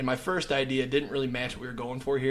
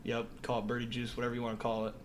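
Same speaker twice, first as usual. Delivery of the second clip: normal talking voice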